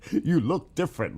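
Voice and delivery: laughing through the words. Real, not acted. Teasing a viewer who looks different, laughing. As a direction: giggle it out at a viewer's new photo.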